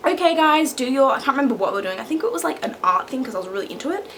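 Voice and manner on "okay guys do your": loud, higher teacher addressing the class; raised imitation classroom voice; Missus Sarah. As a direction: call the little ones to their task. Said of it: "Missus Sarah was there, and she was like".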